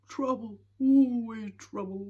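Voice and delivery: a hint of a weary grumbling Hemulen; muttered grumble. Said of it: "he muttered".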